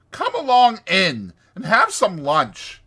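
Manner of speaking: high, loud, hearty coaxing; diplomatic. A narrator's reading. Toad smoothing over the argument, diplomatic. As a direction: invite them in with breezy persuasion. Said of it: "he said diplomatically".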